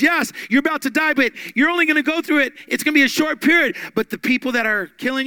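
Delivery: loud, high, fast preaching; preaching at full force. Acted. Preacher urging the suffering church, full-force shout.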